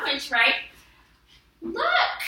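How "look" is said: eager, pointing it out; excited look. Shown as a demonstration. Teacher reads her exclamation sentence, excited. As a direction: look jumps way up, rising. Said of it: "Because I'm excited that they have".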